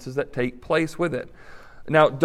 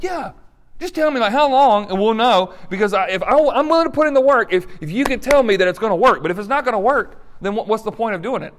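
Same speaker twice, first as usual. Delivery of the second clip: much higher, pleading for a deadline; higher, louder insistent plea; insistent demand; 6 semitones higher, 4 dB louder than usual. Retold or imitated. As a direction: voice the spouse pressing for a timeline. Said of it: "The person who had been unfaithful said".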